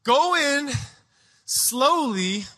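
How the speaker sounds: measured urgent command, word by word; halting orders, starting high and settling. Retold or imitated. Holy Spirit stopping a panicked mother, urgent command. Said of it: "the Holy Spirit just gets a hold".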